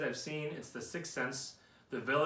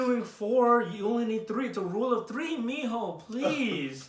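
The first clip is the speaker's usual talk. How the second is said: higher, slower mock Mexican mom accent; mom's accent; 7 semitones higher, 5 dB louder, slower than usual. Imitating his mom with a Mexican accent, higher and slow. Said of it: "Just everything in that accent".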